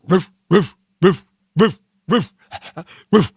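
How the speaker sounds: short, sharp barked roofs; dog bark. Shown as a demonstration. Host showing how dogs bark, short dog barks. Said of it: "you know how dogs bark".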